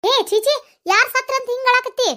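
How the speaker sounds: high-pitched, exasperated rebuke; scolding. Acted. Elder sister to wailing younger one, scolding.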